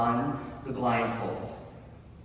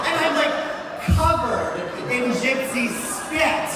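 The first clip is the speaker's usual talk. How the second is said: high, loud, breakneck excitement; worked-up storyteller at the climax; frenzied retelling; 11 semitones higher, 6 dB louder than usual. Retold or imitated. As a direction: build the story to a fever pitch. Crescendo of the ritual retold.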